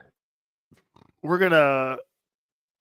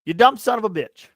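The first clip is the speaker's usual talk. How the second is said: a raised, louder angry insult at the fan; furious rant; 4 semitones higher, 6 dB louder than usual. Retold or imitated. Podcaster ranting at a rude fan, loud angry insult. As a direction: spit the insult out in anger. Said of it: "let me go on a little rant here".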